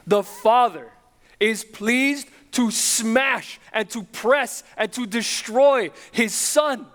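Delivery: rising pulpit intensity, pleading and urgent; impassioned preaching. Acted. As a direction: drive it home from the pulpit. Preacher builds to full force.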